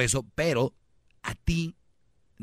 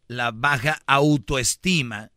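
"la baja autoestima" stretched out slowly and louder; slow, clear enunciation; 6 dB louder, much slower than usual. Shown as a demonstration. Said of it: "como lo digo rápido no se entiende". ("la baja autoestima", dicho lento y bien marcado)

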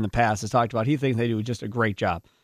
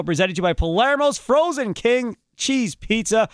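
high, punched-up radio sponsor read; hyped-up radio host pitching pizza; 10 semitones higher, slower than usual. Acted. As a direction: sell it big, stretching the last words high. Reads the show sponsor.

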